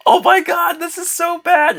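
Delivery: very high, squealed disbelief; high-pitched, amused mock horror; mock dismay. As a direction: react in an exaggerated high voice. Mocking reaction after laughing.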